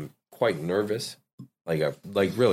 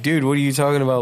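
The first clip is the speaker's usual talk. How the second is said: quick, high, louder baffled outburst; 8 semitones higher, 5 dB louder, much faster than usual. Retold or imitated. Host replays his confused reaction to friends. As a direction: toss it off fast like you're lost. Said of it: "I'm over here like".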